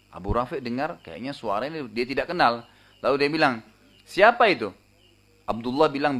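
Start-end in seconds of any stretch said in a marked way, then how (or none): 4.1-4.7 sharp startled question, louder and higher; alarmed call. Retold or imitated. Abu Rafi calling out after hearing an unfamiliar voice, startled. Startled victim calls out in dark.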